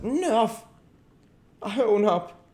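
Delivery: high, choked surrender; strangled gasp. A narrator's reading. King giving in as he is choked, gasping. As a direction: gasp it out while being throttled. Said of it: "and then he gasped out".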